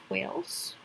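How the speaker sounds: ordinary talk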